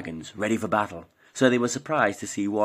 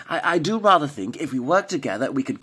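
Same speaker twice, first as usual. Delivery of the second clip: put-on higher, hesitant, prim elderly lady; polite old lady; 6 semitones higher, 4 dB louder than usual. A narrator's reading. Ethel answers Norman politely, a prim higher old lady. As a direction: stammer politely like a genteel pensioner.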